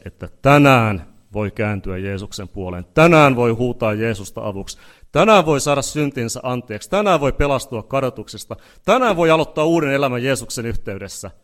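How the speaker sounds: fervent revival preacher; building altar call. Acted. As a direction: drive the repeated today home, rising. Repeated "today" altar call.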